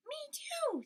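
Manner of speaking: high whispered squeak; breathy little mouse; tiny mouse. Acted. Field mouse joining in, tiny high whisper.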